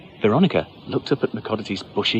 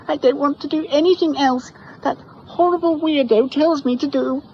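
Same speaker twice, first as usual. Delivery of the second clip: high, loud sulky whine; girl refusing her mother; whiny child; 5 dB louder than usual. Acted. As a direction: sulk it like a child.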